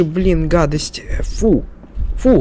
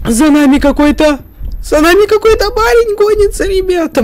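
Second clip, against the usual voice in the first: panicked call to viewers; being chased; 10 semitones higher than usual. Real, not acted. Chased by a man in game.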